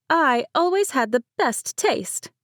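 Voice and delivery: high, slow teasing retort; shot back. A narrator's reading. Bride teases her cousin back about boys. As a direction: toss the comeback playfully. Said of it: "Mercedes shot back".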